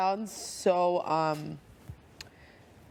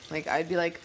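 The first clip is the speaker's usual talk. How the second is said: ordinary talk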